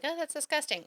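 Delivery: high-pitched imitation of a disgusted objector; mock disgust. Imagined critics reacting to her lunch, mock disgust.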